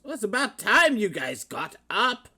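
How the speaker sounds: put-on loud, high old bird voice; gruff mentor. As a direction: hail them loud and high.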